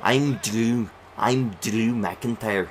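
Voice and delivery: attempted Scottish accent, slow by the end; wobbly Scottish imitation that drifts into other accents; Drew McIntyre. Shown as a demonstration. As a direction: introduce yourself in an amateur Scottish accent. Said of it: "I'm trying to do it".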